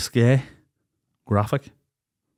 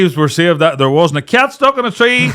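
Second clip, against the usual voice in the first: loud, high, impassioned hype; fervent tall-tale bragging; emphatic boasting; 7 semitones higher, 8 dB louder than usual. Real, not acted. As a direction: belt it out in rising praise. Excited boast about his firemen friends.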